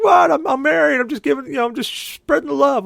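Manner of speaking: high, loud, giddy self-defence; excited protest. Retold or imitated. Brother defends handing out drinks.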